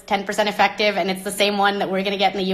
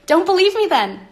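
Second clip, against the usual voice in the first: defiant; 9 semitones higher than usual. Real, not acted. Defiant challenge to doubters.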